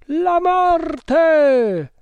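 very high, loud, drawn-out cry; piercing fanatical shout; crowd verdict. A narrator's reading. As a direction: howl the sentence as a frenzied chorus. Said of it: "ripeterono gli indiani".

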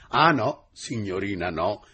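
a sudden loud burst of protest; agitated exclamation. Acted. Secretary bursts out at the teacher's refusal, agitated. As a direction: blurt it out in alarm. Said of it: "esclamò don Celzani agitandosi".

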